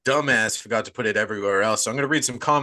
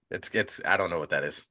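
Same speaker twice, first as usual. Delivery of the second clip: normal talking voice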